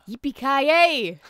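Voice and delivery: slow, loud mock Scottish; bad Scottish accent. Trying Die Hard catchphrase in Scottish accent. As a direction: stretch it out in a hammed-up brogue. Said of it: "give me something else to say".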